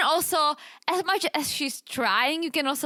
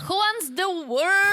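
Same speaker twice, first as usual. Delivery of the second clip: mock outrage; 7 semitones higher, slower than usual. Real, not acted. Echoing partner in mock outrage.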